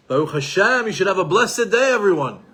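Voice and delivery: a mild cheerful shop greeting; cheerful blessing. Retold or imitated. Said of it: "trying to say".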